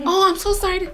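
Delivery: very high, sympathetic coo; sympathetic cashier. Retold or imitated. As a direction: soothe her with sympathy. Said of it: "she was like".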